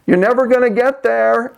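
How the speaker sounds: high taunting yell at the engineer; mock scream. Mathematician yells his taunt at the engineer, high mock shout. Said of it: "the mathematician screams out to him".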